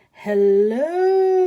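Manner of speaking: loud, drawn-out hello; shouted hello. Acted. Tortoise shouting a greeting at the cactus, loud and long. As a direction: call out big to get noticed. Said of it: "shouted a tortoise".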